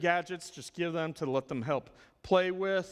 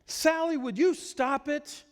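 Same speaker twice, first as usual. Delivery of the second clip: higher, louder impatient scolding; 11 semitones higher, 4 dB louder than usual. Shown as a demonstration. Teacher scolding a worked-up four-year-old, loud and impatient. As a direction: snap at the child to stop, voice raised high. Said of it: "I'm not gonna sit here and".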